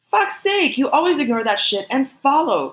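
high-pitched angry outburst; exasperated scolding. A narrator's reading. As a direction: scold a friend, voice jumping up. Kenta scolds Shin by phone.

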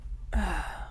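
soft, deflated sigh; dejected sigh. Retold or imitated. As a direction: let out a quiet, saddened breath. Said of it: "I was just sitting there like".

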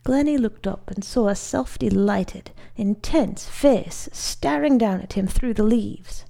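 ordinary delivery, nothing marked

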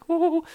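high hammed-up ho ho ho; mock hearty laugh. Mimicking the guy laughing at his own joke, hammed up. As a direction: fake a jolly chuckle.

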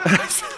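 high, helpless laughter; real burst of laughter. Real, not acted. Grandpa cracking up at the grandson's trash joke, laughing hard.

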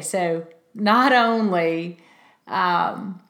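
ordinary delivery

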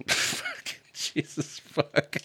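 soft, breathy laughing curse; laughing exasperation. Real, not acted. Laughing at the surgeon bit, soft curse.

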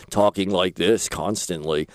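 mock his partner's deep morning voice, low and soft; deep announcer voice. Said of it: "You sound like the voice guy".

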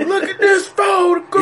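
high, strained mock [name] wail; [name] singer impression. Mocking the photograph song singer, hammed-up rock wail. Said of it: "The guy's delivery".

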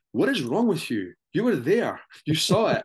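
incredulous retold telling-off; exasperated scolding. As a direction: imitate a wife telling you off, hitting each phrase hard.

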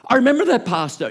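lightly voiced eager churchgoer; eager gossip. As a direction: chime in like a keen church gossip. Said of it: "and they go".

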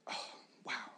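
hushed whisper of amazement; whispered awe. Acted. Onlookers murmuring about Jesus' love, in a whisper. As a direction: breathe it out as a bystander's whisper. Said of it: "you hear the whispers of people".

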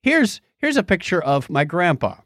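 normal talking voice

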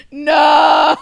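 a big high outcry of dismay; mock anguished cry. Reliving breaking down at the trailer, a loud high cry. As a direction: wail it like the tears just came.

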